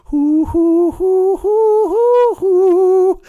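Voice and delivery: high, loud breathy hoo hoo like an air flute; high flute hoots. Pretend. Comic playing air lute, hooting a flute tune high and loud. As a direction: mimic a flute with piercing hoots. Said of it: "fingering air lute as if it were a flute".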